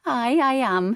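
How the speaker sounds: cheeky agreement. A narrator's reading. Wife cheekily agrees to husband, high playful. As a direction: own it with a grin. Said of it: "she agreed".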